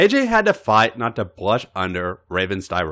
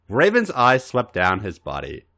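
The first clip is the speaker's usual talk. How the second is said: usual speaking voice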